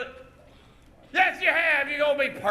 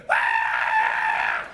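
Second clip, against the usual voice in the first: very high, wild scream; crazy hillbilly holler; 4 dB louder than usual. Shown as a demonstration. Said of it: "I want a crazy hillbilly".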